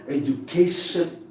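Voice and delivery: "education" with greater force on CA; stress on CA. Shown as a demonstration. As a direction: punch the third syllable of "education", edu-CA-tion. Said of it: "K should be pronounced with greater force".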